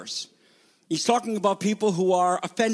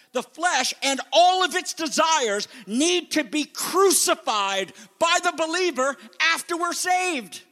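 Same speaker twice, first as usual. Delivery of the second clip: high, ringing pulpit delivery with long pauses; forceful preaching; 9 semitones higher, 4 dB louder than usual. Real, not acted. Preacher telling believers to crucify the flesh, forceful preaching. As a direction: hammer out each phrase from the pulpit.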